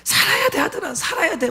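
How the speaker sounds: 우는 목소리로 높게 떨리며 애원하듯; 아들을 안고 울며 살아야 한다고 외치듯; crying plea. Retold or imitated. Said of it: "막 너무 울었어요". (꿈속에서 아들을 안고 울부짖는 아빠, 높은 울음 섞인 애원)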